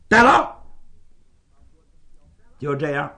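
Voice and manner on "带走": loud, higher commanding shout; sharp command to take him away; barked order. Acted. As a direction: bark it like a captain ordering men. Bai Fu orders the arrest.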